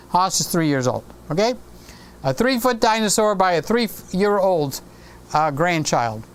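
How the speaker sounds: normal talking voice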